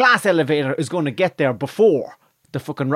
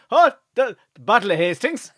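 put-on higher-pitched surprised Dublin accent; joke-telling Dublin customer voice; 7 semitones higher than usual. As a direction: voice a puzzled Dubliner. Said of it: "he's from Dublin".

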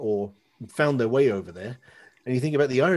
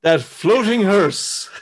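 mock gloomy announcement; 7 semitones higher, 7 dB louder, much slower than usual. Joining the comic bit.

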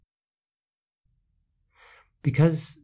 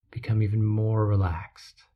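usual speaking voice

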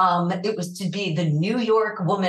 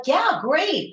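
excited yes; 10 semitones higher than usual. Retold or imitated. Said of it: "And I was like".

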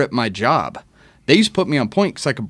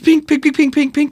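sharp high pink pink bullet snaps, louder; imitated bullet snaps; 4 dB louder than usual. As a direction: spit out each pink like a round cracking past. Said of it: "and all it was was".

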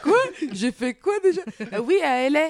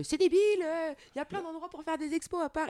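high, exaggerated mom voice; imitating her mother; 11 semitones higher than usual. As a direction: put on a shrill scolding mother. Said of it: "Elle a pas du tout cette voix".

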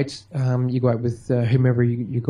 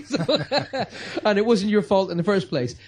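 usual speaking voice

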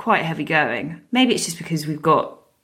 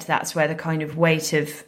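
usual speaking voice

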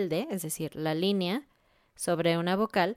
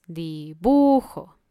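"dibujo" with a long, loud BU; correct penultimate stress; 6 dB louder, much slower than usual. Shown as a demonstration. Teacher giving the answer, strong middle syllable in "dibujo". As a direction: punch and stretch the BU in "dibujo". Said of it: "el sonido fuerte es el sonido penúltimo".

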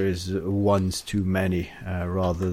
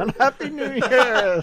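laughing greeting; 4 dB louder than usual. Acted.

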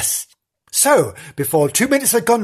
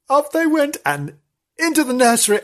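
higher; 9 semitones higher than usual. Shown as a demonstration.

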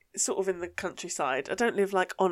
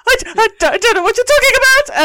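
high, loud, bewildered squeak; baffled squeak; 12 dB louder than usual. Retold or imitated. Driver baffled by the steward's jargon, high flustered squeak. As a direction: sound utterly lost and flustered. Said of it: "And I'm like".

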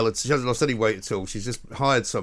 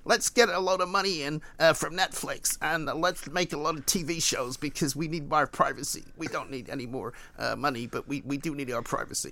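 Meghan impression; 4 semitones higher, 5 dB quieter than usual. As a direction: purr it like Meghan asking Harry. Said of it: "classic Meghan impression".

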